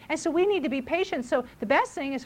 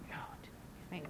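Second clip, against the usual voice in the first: mock whisper; 22 dB quieter than usual. Mocking parent hushing child.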